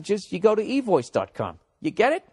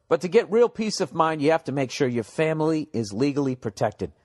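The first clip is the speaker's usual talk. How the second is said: ordinary talk, nothing marked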